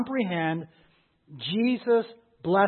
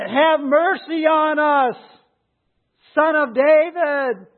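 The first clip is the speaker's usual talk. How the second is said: slow, high, loud cry, drawn out; desperate plea; 9 semitones higher, 8 dB louder, slower than usual. Acted. Preacher voices blind men calling for mercy, desperate plea. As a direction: wail it out as an acted, incessant appeal. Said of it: "It was a desperate plea".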